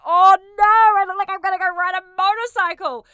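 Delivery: loud, high, exaggerated wail of embarrassment; mock dismay. Host retells being judged by stylist friend, mock dismay.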